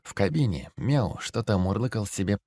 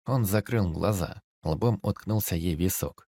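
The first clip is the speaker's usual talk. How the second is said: plain reading voice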